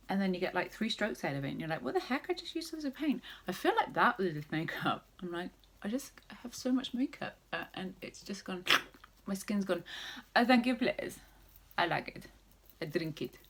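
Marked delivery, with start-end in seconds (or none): 1.8-3.2 high-pitched mock disbelief; mock exasperation. Someone painting walls baffled at vanished paint, mock exasperated. 10.3-13.4 jokey, badly faked Russian accent. Vlogger clowning while doing her makeup, fake Russian accent. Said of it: "the worst Russian accent".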